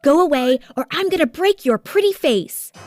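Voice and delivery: loud, high angry warning; screamed threat. A narrator's reading. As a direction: threaten the sister, pitch climbing.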